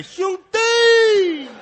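high, loud, very slow shout. Acted. Old man shouting out to his benefactor, very slow loud shout. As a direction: bellow the word like a hawker's cry. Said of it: "越叫越脆上".

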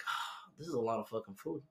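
low, soft muttered complaint; mock quiet groan. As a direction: grumble it under your breath. Retold reaction at brunch.

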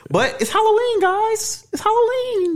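high sing-song Halloween call; excited. Acted. Sing-song holiday announcement.

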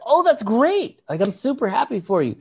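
high, gushing well-wishing; thrilled for a friend; delighted congratulations. Retold or imitated. Acted congratulating reply to friend.

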